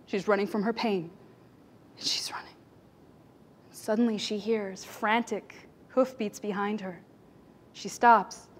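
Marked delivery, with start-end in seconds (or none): 2.0-2.5 a hushed, spent whisper; fading whisper. A narrator's reading. Storyteller whispering as the mother flees, hushed. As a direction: whisper it, trailing away.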